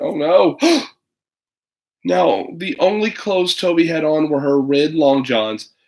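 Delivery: ordinary delivery, nothing marked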